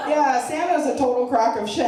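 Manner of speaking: ordinary talk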